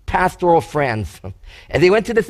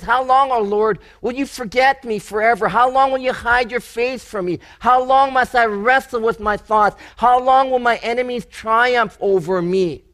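pleading lament, higher; 5 semitones higher than usual. Acted. David crying out to God in his troubles, higher pleading voice. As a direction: plead each how long, raised higher.

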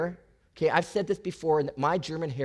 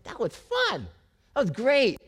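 delighted; 6 semitones higher than usual. Retold or imitated. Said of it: "you're like".

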